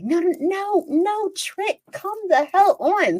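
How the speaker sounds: high-pitched string of acted "no"s; exasperated refusal. Dragging friend away from the man, exasperated and insistent. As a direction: urgently shut down a lingering friend.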